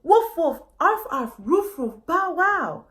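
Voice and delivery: spreading the alarm in dog language; excited barking. Acted. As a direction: bark the news high and loud. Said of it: "news spread".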